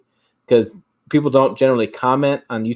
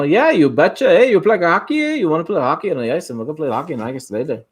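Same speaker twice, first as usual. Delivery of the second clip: hammed-up Minnesota hockey-player accent, higher at the start; 3 semitones higher than usual. Streamer showing off his Minnesota accent to chat, in broad Minnesota dialect. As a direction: put on a chirpy Minnesota lilt. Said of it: "Minnesota accent".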